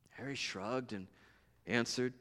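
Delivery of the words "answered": usual speaking voice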